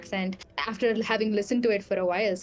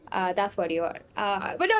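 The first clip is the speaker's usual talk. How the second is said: normal talking voice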